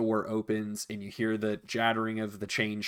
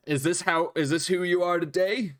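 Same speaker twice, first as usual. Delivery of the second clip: innkeeper's voice; 6 semitones higher than usual. Acted. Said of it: "The innkeeper looks at you and he says".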